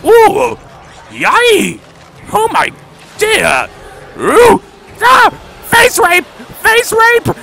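high, loud startled screams; shrieking. Real, not acted. Player screaming at monsters leaping at him. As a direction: yelp at each jump scare.

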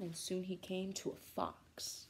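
usual speaking voice

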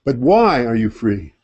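ordinary talk

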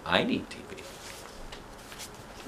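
soft slow whisper. Real, not acted. Reader confessing aside to listener, whisper. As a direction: confess it under breath. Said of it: "aside whispered".

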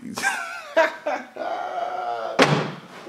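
real high-pitched laughing fit; high shrieking laugh. Real, not acted. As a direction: burst out in a high cackle. Cracking up at the line.

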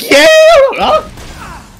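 very high excited yeah and squeal. Retold or imitated. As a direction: squeal it with delight. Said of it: "let me rephrase".

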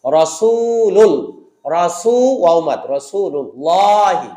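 রাসূলুল্লাহি slow, with a thick ল; heavy L after pesh. Shown as a demonstration. Teacher modelling রাসূলুল্লাহি slowly for pupils. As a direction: stretch রাসূলুল্লাহি and darken the lam. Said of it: "পেশ আল্লাহ শব্দের তাজদিদকে ধরলো".

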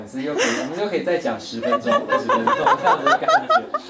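the words break into high, loud laughter; laughing. Real, not acted. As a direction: laugh it out, teasing the guest. Host teases guest about talking more.

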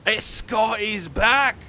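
drawn-out, high hype call; wrestler's promo shout. Acted. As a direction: announce a big comeback to the camera, stretching it out. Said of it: "turns around towards the camera and is like".

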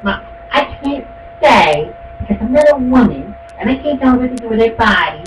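high, dithering mock voice of a wishy-washy churchgoer; mocking waffler. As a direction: stammer it out like a spineless fence-sitter. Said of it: "everyone that supports".

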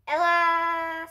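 slow right down for the moment; slow deliberate. Acted. Narrator marking Ella's sudden stop, slow.

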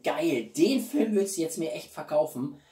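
ordinary talk, nothing marked

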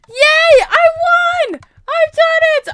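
very high, loud squeal of victory; elated shouting, last words drawn out; triumphant cheer. Real, not acted. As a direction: shriek with joy at winning. Celebrates winning the game.

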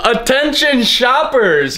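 store announcer voice. Pretend. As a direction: boom it like a store PA.

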